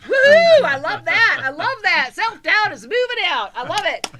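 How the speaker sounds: loud, high-pitched whoop of celebration; jubilant cheering. Real, not acted. Host celebrates caller's self-doubt moving out, jubilant cheering. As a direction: cheer it on with big swooping pitch.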